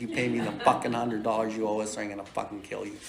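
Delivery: low, quiet retold threat with a laugh at the end; menacing threat. Scene: in the boss's face demanding his owed pay, low menace. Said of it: "'Cause I'm angry and violent".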